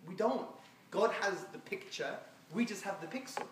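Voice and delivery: usual speaking voice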